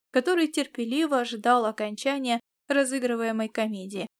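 plain reading voice

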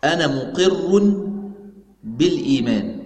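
slow, spaced-out dictation for note-taking. Shown as a demonstration. Teacher dictating the answer slowly to students. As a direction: give each word time so students can write it. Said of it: "الخلاصة نكتبها".

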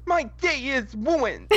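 high, slow melodramatic wail; mock tragic. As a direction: overplay the heartbreak. Mock tragedy over wings.

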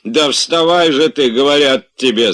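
loud, high exasperated bellow; roaring. A narrator's reading. Servant losing patience with sleeping master, roaring. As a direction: roar at him to get up. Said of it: "заревел было Захар".

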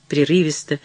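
ordinary delivery

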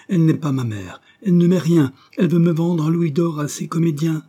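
put-on high, loud, frightened child's plea; panicked plea. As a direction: beg for rescue, voice climbing. Child begs for help. (supplication apeurée d'enfant)

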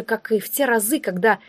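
plain reading voice

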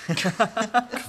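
short high burst of laughter; boyish laugh. Acted. Boy laughs off the bully's mocking question. Boy boasting, caught on apples.

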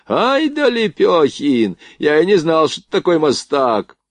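high, drawn-out cheer that eases down; egging him on. A narrator's reading. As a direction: goad him with mock admiration. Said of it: "подзадоривал Борейко".

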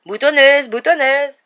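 high, loud repeated jeer; taunting shout. A narrator's reading. Poltergeist chasing the ghost girl, shouted taunt. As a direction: yell it as a mocking chant. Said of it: "la bombardait de cacahuètes pourries en criant".

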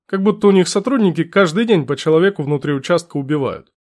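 ordinary delivery, nothing marked

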